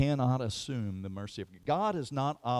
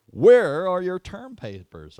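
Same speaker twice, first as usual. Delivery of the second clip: loud, slow and higher demand; demanding professor; 10 semitones higher, 16 dB louder, slower than usual. Retold or imitated. Professor demanding papers from the class, loud and slow. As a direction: call out the question to the class.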